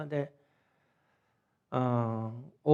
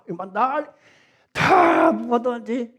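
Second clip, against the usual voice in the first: laughing; 9 semitones higher, 11 dB louder, much slower than usual. Real, not acted. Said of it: "laughing while telling".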